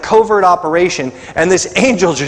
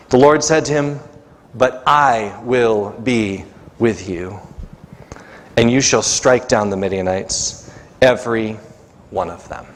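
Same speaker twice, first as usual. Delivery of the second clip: measured, lower Bible reading voice; scripture reading; 4 semitones lower than usual. Acted. Pastor reading the Gideon story to the congregation, solemn reading.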